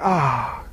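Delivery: soft low contented ah; content sigh. Retold or imitated. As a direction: breathe in the morning air happily. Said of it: "would you wake up and be like".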